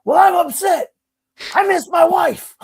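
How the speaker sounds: very high, loud, distraught shout; yelled outburst. Retold or imitated. Upset neighbor confesses to girlfriend, overwrought mock yelling. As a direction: imitate the neighbor yelling his heartbreak. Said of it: "He literally yelled at".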